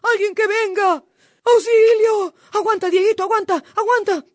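loud, high panicked cries for help; hammed-up storybook panic; frightened fox. A narrator's reading. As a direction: yell for rescue in a frenzy. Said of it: "el pobre tío zorro se asustó".

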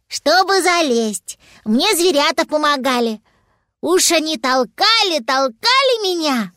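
put-on high, childlike character voice; little dragon. Little dragon telling Papa how he climbed, high-voiced. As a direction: explain it like a little one.

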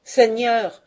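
put-on an old man begging the lord, loud and high; crying out. A narrator's reading. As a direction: cry out desperately to the lord. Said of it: "criait-il".

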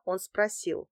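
usual speaking voice

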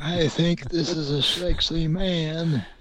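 a high, slow, silly misquote; silly mock voice. Comic mishearing of the quote.

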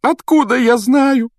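loud, slow, very high-pitched howl; hammed-up hysterical wail. A narrator's reading. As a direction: howl it out as an aggrieved ghost girl. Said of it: "заголосила Миртл".

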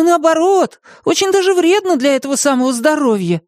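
high-pitched squeaky argument; nervous, high-voiced small creature; eagerly agreeing Martyn. A narrator's reading. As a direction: talk your way out of it, groping for words. Martyn gabbles support for vegetarian food.

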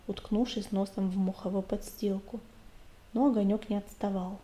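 ordinary delivery, nothing marked

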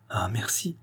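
breathless whispered thanks; relieved whisper. Acted. Doctor relieved by reassurance. (murmure soulagé, chuchoté)

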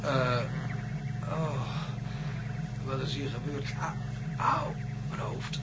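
whispered in pain, slow and breathy with hurt; pained groan. Acted. John coming to after the blow, pained whisper. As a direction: wake up hurting, barely getting the words out.

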